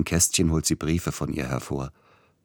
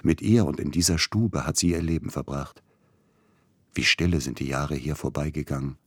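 plain reading voice

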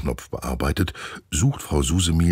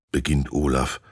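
ordinary delivery, nothing marked

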